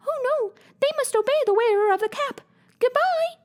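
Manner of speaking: very high, tiny squeaky voice; squeaky mouse queen. A narrator's reading. Mouse queen greets Dorothy in a tiny high squeak. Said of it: "squeaky little voice".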